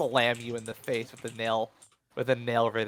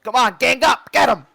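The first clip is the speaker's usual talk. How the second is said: high, full-force rallying shout; yelled command; 11 semitones higher, 14 dB louder than usual. Acted. Boss rallies the others to gang up, yelling. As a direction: yell orders to your gang. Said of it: "yells at the others".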